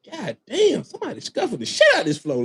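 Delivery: high, fast, laughing mock exclamation; amazed player. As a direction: play the player who can't believe the floor. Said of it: "one of the players on the floor say".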